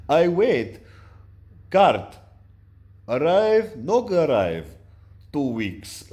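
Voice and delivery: loud, high, halting broken phrases; mock my own clumsy foreign-language attempt; broken Hebrew learner. To a call-centre agent, loud halting broken words. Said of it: "I probably sounded like this".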